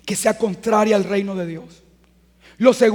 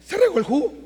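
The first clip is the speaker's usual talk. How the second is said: higher, light matter-of-fact statement of the spill; plain reply; 7 semitones higher, 4 dB quieter, faster than usual. Shown as a demonstration. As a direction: say Se regó el jugo lightly and higher, just stating the fact. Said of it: "¿tú qué dices?".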